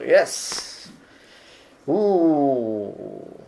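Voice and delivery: ordinary talk, nothing marked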